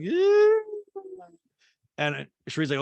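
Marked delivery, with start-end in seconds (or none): 0.0-0.6 squeaky yeah. Retold or imitated. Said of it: "Diana's like".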